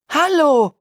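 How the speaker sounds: high called greeting; calling out. Acted. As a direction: call under the sofa. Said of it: "rief Jim".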